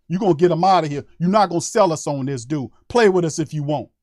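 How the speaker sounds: ordinary talk, nothing marked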